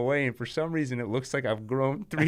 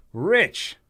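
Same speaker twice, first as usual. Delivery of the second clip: higher, louder gloating cry; gleeful shout; 7 semitones higher, 7 dB louder than usual. Acted. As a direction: gloat over the word. Villain gloating.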